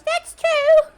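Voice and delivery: squeaky high goblin; shrill little goblin squeal. Acted. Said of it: "The quieter goblin in the back goes".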